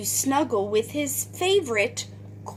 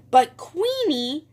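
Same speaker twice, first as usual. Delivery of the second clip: usual speaking voice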